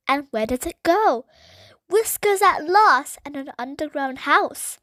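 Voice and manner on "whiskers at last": excited. A narrator's reading. Child thinks she found the mouse.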